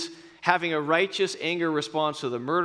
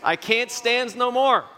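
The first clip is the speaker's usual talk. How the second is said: raised, slow cartoon sailor growl; Popeye voice; 6 semitones higher, 4 dB louder, slower than usual. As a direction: ham up Popeye losing patience. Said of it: "he would say".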